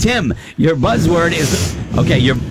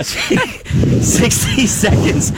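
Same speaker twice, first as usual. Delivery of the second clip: laughing; 6 semitones higher, much slower than usual. Real, not acted. Host cracking up mid-read.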